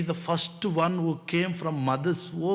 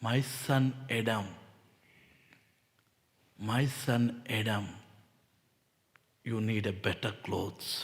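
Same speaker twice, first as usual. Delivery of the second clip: tender God voice; 5 semitones lower, 5 dB quieter than usual. Acted. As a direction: speak to Adam like a loving father. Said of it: "you know what he did?".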